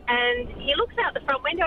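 usual speaking voice

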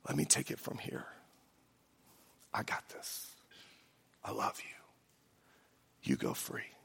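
soft breathy whisper, very slow on I love you. A narrator's reading. Jesus whispering to the condemned sinner, tender whisper. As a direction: lean in and whisper it close to the ear. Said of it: "he whispers in our ear".

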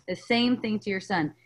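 ordinary talk

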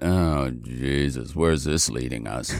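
low, slow mock groan; weary dread. Guest retelling band hearing about the ferry, weary dread. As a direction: sink into resigned dismay.

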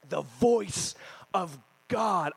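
slow, drawn-out awe; slow reverent pronouncement; reverent emphasis. Acted. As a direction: savour each word like holy words. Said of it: "there's nothing like the voice of God".

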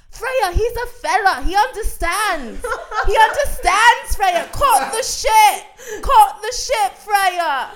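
drawn-out, very high mock-British yelling; [name] screaming. Boyfriend appeals to the Uber driver against girlfriend, screaming in British accent. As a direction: bellow each phrase slowly in a put-on British accent. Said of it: "you're screaming at me in the Uber".

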